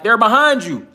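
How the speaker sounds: high, loud taunt, lightly voiced; mocking elites. Elites taunting through the pool glass.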